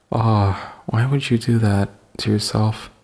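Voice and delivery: normal talking voice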